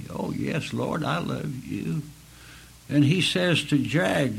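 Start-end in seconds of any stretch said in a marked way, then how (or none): 0.1-2.0 much softer, tender answer to the Lord. Acted. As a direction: answer him softly, close and humble. Peter answers Jesus softly.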